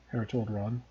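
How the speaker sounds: ordinary delivery, nothing marked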